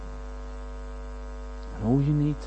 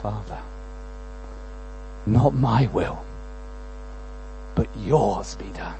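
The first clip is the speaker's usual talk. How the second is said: quiet surrender; 6 semitones lower, 8 dB quieter than usual. Acted. Jesus surrendering in prayer.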